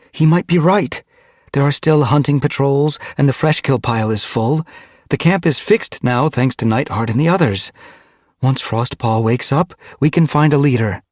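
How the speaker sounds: loud, high, insistent case-making; arguing. A narrator's reading. Mothwing insists the clan can survive, arguing. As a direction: press the argument urgently, point after point. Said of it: "Mothwing argued".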